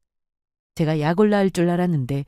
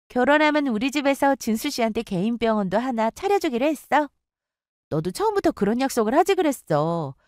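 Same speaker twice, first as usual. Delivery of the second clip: higher, mocking boastful friend voice; bragging; 7 semitones higher than usual. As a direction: taunt her with it.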